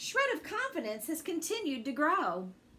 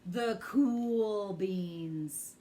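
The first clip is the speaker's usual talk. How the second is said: low, slow drawn-out "The cool beans"; slow, low, laid-back cool voice; deep cool echo; 6 semitones lower, much slower than usual. Acted. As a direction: say it like the coolest guy around.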